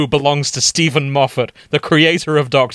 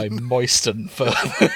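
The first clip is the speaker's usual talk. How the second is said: slow, laughing repeat. Real, not acted. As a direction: savour it while laughing.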